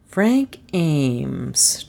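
drawn-out, pondering repetition; musing, trying to place it; thoughtful. A narrator's reading. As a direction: turn the name over slowly in thought. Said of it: "Trent repeated thoughtfully".

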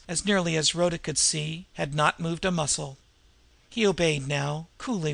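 ordinary delivery

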